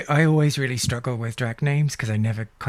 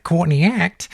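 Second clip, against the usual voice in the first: Australian accent; 4 semitones higher, 5 dB louder than usual. Shown as a demonstration. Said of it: "makes total sense in an Australian accent".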